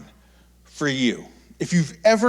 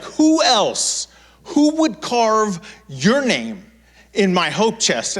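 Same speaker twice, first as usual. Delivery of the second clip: much higher, slow and drawn out; mock the outraged mother; hammed-up accusing mother; shrill angry mom; 5 semitones higher, slower than usual.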